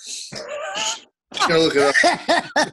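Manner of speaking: slow words through laughter; laughing. Real, not acted. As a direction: laugh it out, drawn out. Laughing while admitting he forgot.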